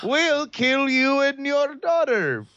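put-on slow, high menacing Russian accent; heavy russian accent menace. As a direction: threaten slowly in a Russian accent. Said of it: "dark reboot of Yakov Smirnoff".

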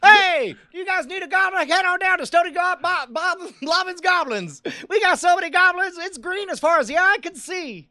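high, loud hammed-up salesman pitch; cheesy local TV ad for a goblin shop; pitchman. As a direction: sell goblins like a used-car ad. Mock goblin-shop commercial.

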